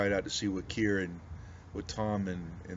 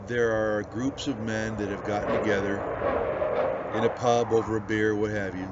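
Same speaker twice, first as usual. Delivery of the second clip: preaching; 10 dB louder than usual. Acted. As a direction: preach it out to the whole congregation. Builds into full preaching.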